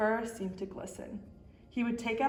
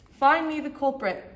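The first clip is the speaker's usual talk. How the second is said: loud, high barked command; police chief loudly ordering his men to find the culprit; 6 semitones higher, 8 dB louder than usual. Acted. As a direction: bark it at your officers. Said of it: "barked the chief of police".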